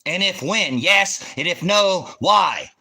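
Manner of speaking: loud, high, barked demands; angry shout. Real, not acted. Streamer shouting yes-or-no terms at a viewer. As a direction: hammer each word out.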